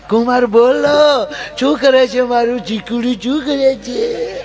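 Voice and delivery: high, slow, sugary motherly coo; fawning mother-in-law on her first call from her son-in-law, high and slow; doting mother-in-law. Retold or imitated. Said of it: "હાહુનું મોઢું પડ્યું લાપસી ખાવા".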